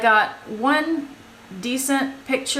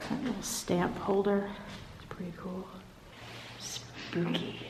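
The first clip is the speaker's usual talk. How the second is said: nervous night vlog whisper; 12 dB quieter than usual. Real, not acted. Night footage in spooky hospital.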